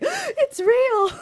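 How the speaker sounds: high excited squeal of wonder; a child seeing Disneyland for real; amazed cry. Retold or imitated.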